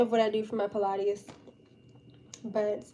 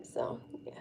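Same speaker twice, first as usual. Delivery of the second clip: whispering; 12 dB quieter than usual. Real, not acted. Closing the topic.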